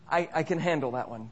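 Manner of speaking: ordinary delivery, nothing marked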